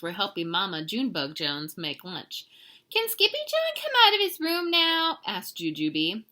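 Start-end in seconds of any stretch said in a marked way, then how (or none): 2.9-5.2 put-on very high, raised childlike question; high-pitched pleading kid voice; little kitten sister. A narrator's reading. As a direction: pipe up high like a small child asking.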